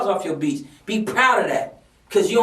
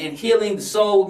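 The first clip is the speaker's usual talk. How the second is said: ordinary delivery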